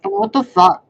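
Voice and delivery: exasperated outburst. Real, not acted. Itchy and irritated, a loud exasperated curse. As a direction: blurt it out in disbelief.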